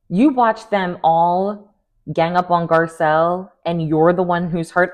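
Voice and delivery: ordinary delivery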